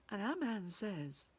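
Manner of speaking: usual speaking voice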